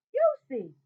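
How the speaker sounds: loud, surprised call of a name; excited loud hello; happy greeting. A narrator's reading. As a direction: greet your friend with a glad shout. Boy greets friend with surprise.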